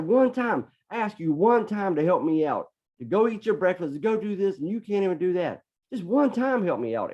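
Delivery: high-pitched exasperated scolding; retold screaming. Dad asking his daughter for help one time, screaming. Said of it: "I started screaming at her".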